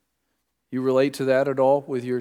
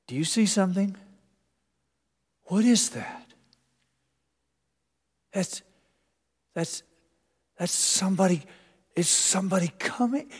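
frightened disciple; 8 semitones higher than usual. Acted. Disciples peering in storm.

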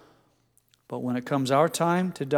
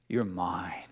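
much softer, whispered into a neck; tender whisper; 7 semitones lower, 10 dB quieter than usual. Retold or imitated. Father holding his child close, whispering tenderly. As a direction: breathe it lovingly, barely voiced. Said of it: "buries his face in the child's neck".

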